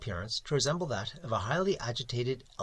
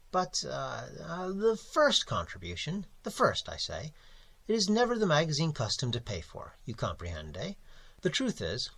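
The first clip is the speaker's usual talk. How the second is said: smooth over the money talk unctuously; Mister Crabbe; 4 semitones higher than usual. Acted. Editor Crabbe explains not paying.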